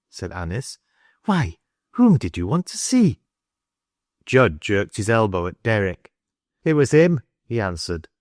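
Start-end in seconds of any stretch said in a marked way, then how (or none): 1.3-3.2 high, swooping voiced questions; questioning woman. A narrator's reading. Woman asks boy whom he wanted, high questioning. 6.6-7.2 higher, lightly voiced boy; nervous boy. A narrator's reading. Caught boy points out the man, nervous. Frightened boy answers in story.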